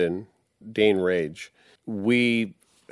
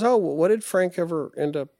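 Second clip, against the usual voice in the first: put-on high curious girlfriend voice; 8 semitones higher than usual. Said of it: "And she goes".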